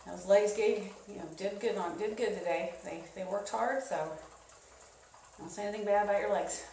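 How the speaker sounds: gentle, reassuring coach; soft cool-down voice. Real, not acted. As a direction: ease them down softly. Cool down encouragement.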